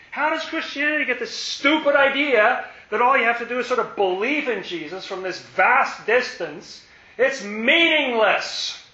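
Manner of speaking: high, loud preaching at full force; impassioned sermon with rising heat; fervent preaching. Real, not acted. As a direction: hammer the point home to the congregation.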